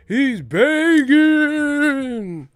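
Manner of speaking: very high, slow, loud haunting wail; ghostly wail. Retold or imitated. Mocking Bradley on air, very high, slow ghostly wail. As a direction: stretch it out like a moaning ghost.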